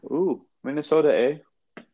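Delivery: playful Upper Midwest "eh" with Minnesota sung high; mock Minnesotan accent. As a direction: tease it like a Minnesotan, ending on a bouncy "eh?". Joking about Minnesota, adding "eh.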